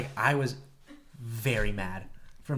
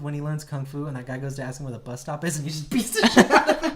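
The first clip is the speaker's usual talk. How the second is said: ordinary talk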